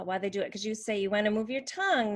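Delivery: ordinary talk, nothing marked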